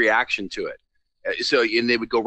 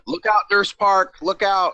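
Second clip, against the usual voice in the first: playful high-pitched call-out; mock warning; 8 semitones higher, 3 dB louder, slower than usual.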